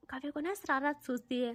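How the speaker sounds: ordinary delivery, nothing marked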